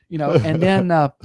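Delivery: ordinary talk, nothing marked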